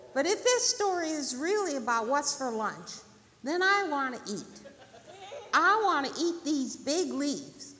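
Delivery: high, loud rabbit voice; hungry, insistent rabbit. Acted. Rabbit wanting the big leaves, insistent.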